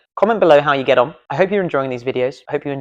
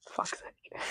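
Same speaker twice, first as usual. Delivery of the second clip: under-breath whispered swear; annoyed aside whispered off mic; whispered curse; 20 dB quieter than usual. Real, not acted. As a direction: mutter it to yourself in a whisper. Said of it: "unvoiced whisper".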